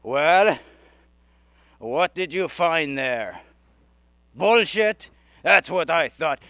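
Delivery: put-on loud, scoffing Russian accent, easing to a smug close; mock the rival in a comic Russian accent. Russians scoff at America's moon landing, comic Russian accent. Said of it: "I could do a Russian accent".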